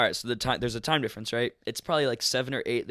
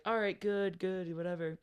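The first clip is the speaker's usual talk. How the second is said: breezy acceptance; 7 semitones higher, 6 dB quieter than usual. Retold or imitated. Retold hospital worker accepting the answer.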